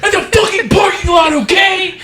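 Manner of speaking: shrieking, cornered dad at a concert; mock screaming dad. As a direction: scream it out in cartoonish outrage.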